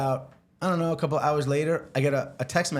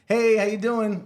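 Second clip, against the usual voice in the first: forced cheerful; 7 semitones higher, 4 dB louder than usual. Retold or imitated. Answering boss on speaker.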